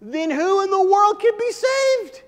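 high, loud, slow amazed outcry; astounded cry. Acted. Preacher voicing astounded disciples, high loud cry. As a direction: cry out in disbelief.

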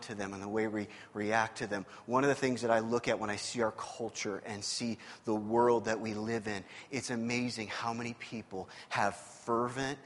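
low, soft, with long pauses; sober preaching reflection. Real, not acted. Preacher reflecting on what's sad about this story, hushed and sober. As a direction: bring the congregation down to quiet reflection.

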